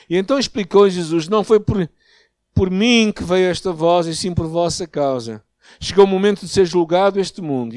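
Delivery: loud, declamatory Bible reading; scripture reading. Real, not acted. Preacher reading the voice from heaven and the crowd, projected scripture reading.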